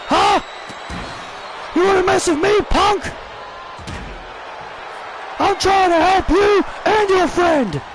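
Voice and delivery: high shouted heel taunt; angry wrestler taunt. Acted. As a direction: yell it down at the beaten opponent. Kevin Owens voiced attacking.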